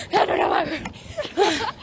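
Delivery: words lost in laughter; laughing. Real, not acted. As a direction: laugh through the sentence. Laughing while talking.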